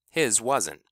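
ordinary delivery

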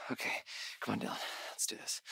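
soft whisper to himself; whispered pep talk. Acted. Dylan whispering himself on, soft unvoiced pep talk. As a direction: psych yourself up under your breath.

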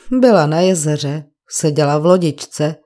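usual speaking voice

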